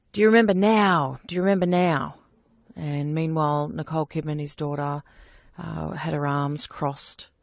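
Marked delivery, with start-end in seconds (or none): 0.1-2.2 raised, louder imitation of a screamed demand; retold scream. Woman recounting her attacker's screamed questions, imitated scream. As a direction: shout it as the remembered attacker, short of a full scream. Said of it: "he was screaming at me".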